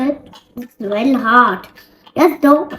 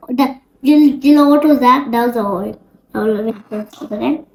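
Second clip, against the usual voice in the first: usual speaking voice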